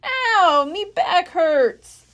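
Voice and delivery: Stinky complaining while digging; cried. A narrator's reading. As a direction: moan about the pain. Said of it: "Stinky cried".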